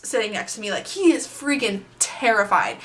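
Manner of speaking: ordinary delivery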